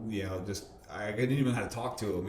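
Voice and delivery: usual speaking voice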